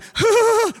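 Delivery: high, mock-panicked wordless whoop; nervous gasp. Preacher imitates a nervous captain on a plane, mock-nervous noise. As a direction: let out a shaky captain's noise, hammed up. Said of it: "If the captain sounds nervous going".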